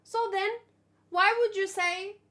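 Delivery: ordinary talk, nothing marked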